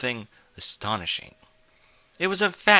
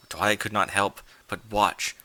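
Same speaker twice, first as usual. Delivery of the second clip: ordinary delivery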